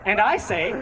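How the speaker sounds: ordinary talk, nothing marked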